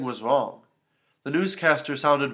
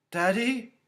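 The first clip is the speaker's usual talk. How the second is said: very high, questioning call; little girl's anxious question; alarmed child; 11 semitones higher than usual. A narrator's reading. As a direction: call into a dead line for Daddy. Child loses father on phone.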